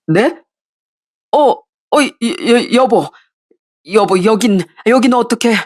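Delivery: stammering, flustered husband; caught off guard. Acted. Husband caught with his mistress, stammering in shock. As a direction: trip over the words in panic.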